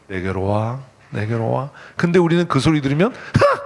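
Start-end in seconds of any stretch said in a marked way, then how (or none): 0.1-1.7 soft call of God. Acted. God calling softly. 3.1-3.6 a high scoffing "ha!"; sharp high mock scoff. Acting scoffing sinner.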